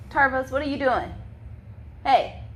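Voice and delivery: calling to dog. Real, not acted. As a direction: call the dog off firmly.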